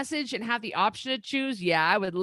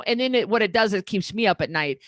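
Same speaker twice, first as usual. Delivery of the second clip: usual speaking voice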